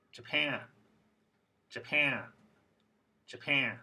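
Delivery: Japan with a long second syllable, ja-PAN; lengthened stressed vowel. Shown as a demonstration. Teacher modelling vowel length for students, stressed second syllable in Japan. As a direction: keep Ja short and stretch PAN. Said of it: "These sounds should sound a little longer".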